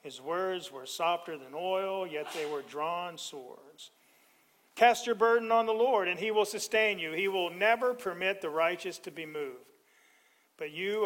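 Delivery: normal talking voice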